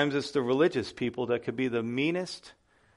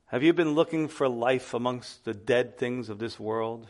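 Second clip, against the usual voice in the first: normal talking voice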